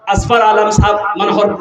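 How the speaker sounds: usual speaking voice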